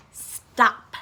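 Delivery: plain reading voice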